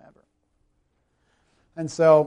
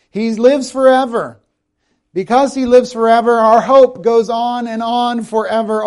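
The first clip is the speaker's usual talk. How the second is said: loud, high, ringing sermon delivery; preaching at full force; 8 semitones higher, 9 dB louder than usual. Acted. Pastor preaching to the congregation, loud and ringing. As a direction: preach it to the back row.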